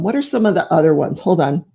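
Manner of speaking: ordinary talk, nothing marked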